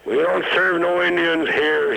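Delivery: loud, high bark of a bouncer. Retold or imitated. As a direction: bark the refusal at the customers. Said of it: "he barked".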